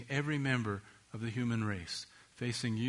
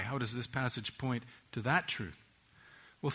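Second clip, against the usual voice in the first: ordinary delivery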